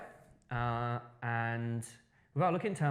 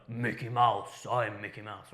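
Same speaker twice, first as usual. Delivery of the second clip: Mickey Mouse; 2 semitones lower, slower than usual. Shown as a demonstration. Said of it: "He doesn't speak like".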